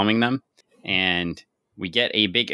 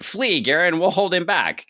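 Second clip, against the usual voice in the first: heroic plea; 9 semitones higher than usual. Retold or imitated. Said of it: "Larissa is all like".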